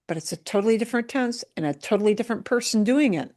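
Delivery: ordinary talk, nothing marked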